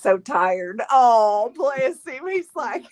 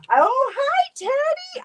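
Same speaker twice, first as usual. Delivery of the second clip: high cooing greeting; slower than usual. Real, not acted. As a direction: coo at the grandbaby. Grandma greeting baby on camera.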